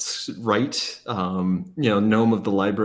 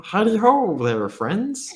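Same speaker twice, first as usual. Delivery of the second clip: cheery gnome greeting; 9 semitones higher, 3 dB louder than usual. Acted. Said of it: "Cauldron's gonna come up and say".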